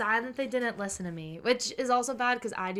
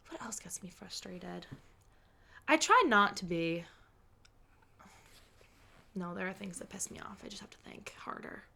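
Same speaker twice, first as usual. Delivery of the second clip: whispered musing to herself; 2 semitones lower, 6 dB quieter than usual. Real, not acted. Thinking aloud about what annoys her, whispering. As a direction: drop to a breathy whisper while thinking. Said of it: "whispers to herself".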